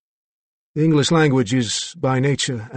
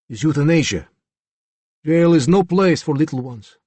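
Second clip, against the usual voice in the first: put-on louder, forceful Slavic accent; indignant Slavic accent, pushing louder; 7 semitones higher, 5 dB louder than usual. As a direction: Slavic accent, defiant and rising. Regan defends himself to lawyers.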